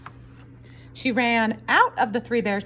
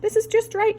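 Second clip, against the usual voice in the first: Goldilocks voice; 13 semitones higher than usual. Acted. Goldilocks in bed.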